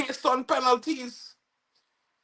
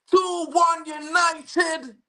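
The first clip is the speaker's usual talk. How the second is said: triumphant shout; 6 semitones higher, much slower than usual. Real, not acted. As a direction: call the lead to the crowd. Announcing the lead.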